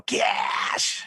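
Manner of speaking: long, rasping voiceless caw; vulture squawk. Retold or imitated. Judge telling the hero what to pay, imitated vulture squawk. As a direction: screech the word like a vulture. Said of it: "like a vulture".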